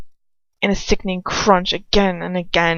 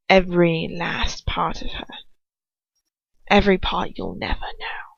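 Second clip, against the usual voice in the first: menacing whisper; 2 semitones lower than usual. A narrator's reading. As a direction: taunt him in a hiss. Lucius taunts in whisper.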